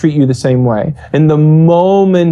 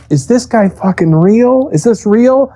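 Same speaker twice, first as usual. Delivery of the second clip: incredulous; 7 semitones higher than usual. Retold or imitated. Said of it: "you're just like".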